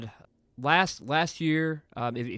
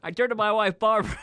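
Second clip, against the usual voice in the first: raised-pitch presidential impression; George Bush; 7 semitones higher, 4 dB louder, faster than usual. Repeating the bit when asked who that was, presidential impression. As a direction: play the folksy president, pitched up. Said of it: "a little Barbara Bush in there with the George".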